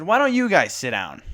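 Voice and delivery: sharp, loud retort; snapping at them. Retold or imitated. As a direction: snap it back at the people behind. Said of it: "I snapped at them".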